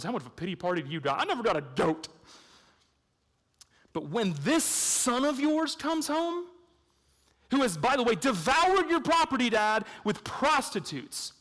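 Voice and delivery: hammed-up indignant brother; angry older brother. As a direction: protest like a bitter son wronged. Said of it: "And in anger, he says to his dad".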